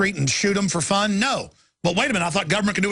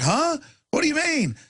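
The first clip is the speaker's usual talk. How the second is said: mock innocent surprise; 9 semitones higher than usual. Feigning innocence to cops.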